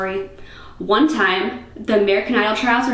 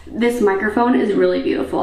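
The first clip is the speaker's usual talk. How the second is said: ordinary talk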